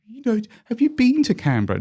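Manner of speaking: very high, patronising posh Australian accent, imitated; mock posh Australian accent. Private school student patronising the country kids, high mock posh Australian accent. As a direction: talk down to them in a put-on posh Australian accent. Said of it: "And he was going".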